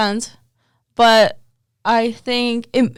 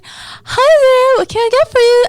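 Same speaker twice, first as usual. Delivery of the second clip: very high, loud barista voice; Valley Girl voice; 10 dB louder than usual. Retold or imitated. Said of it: "Like Valley Girl".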